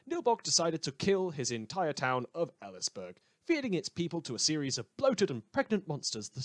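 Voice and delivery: ordinary talk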